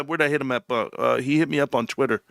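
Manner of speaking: ordinary delivery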